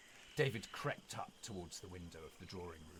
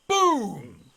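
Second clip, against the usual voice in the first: a huge shouted boom; booming gunshot; 14 semitones higher, 19 dB louder than usual. Acted. Narrator shouting the butler's shotgun blast.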